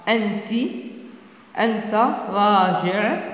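ordinary talk

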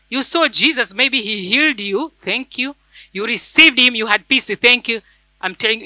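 ordinary talk

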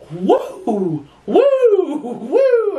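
wheeled down the hall, giddy and high; laughing high woo calls; druggy woo. Retold or imitated. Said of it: "I'm just like".

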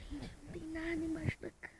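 very soft frightened whisper; scared whisper. Real, not acted. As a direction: barely breathe the words. Whispering under the bed.

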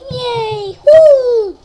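loud happy touchdown cheer. Pretend. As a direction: celebrate the touchdown loudly.

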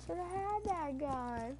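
soft, high, drawn-out whine; imitating a little girl. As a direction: slowly mimic your own girlish whine, quietly. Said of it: "sound like a little girl in that one".

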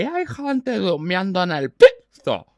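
put-on slow, low drunken mumble. Drunk coming home and stumbling, slurring. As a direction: drag the words out tipsily.

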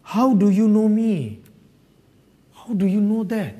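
high, slow, amazed questioning; astonished. Acted. Preacher acts Nathanael questioning Jesus, astonished. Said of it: "he's astonished".

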